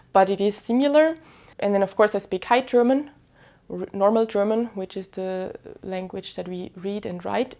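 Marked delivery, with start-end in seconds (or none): none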